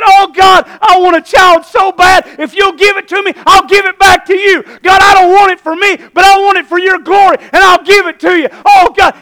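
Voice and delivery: high, loud pleading cry to God; desperate prayer. Acted. Hannah at the temple begging God for a child, desperate prayer. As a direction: beg at the top of your voice. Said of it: "she got so desperate before God".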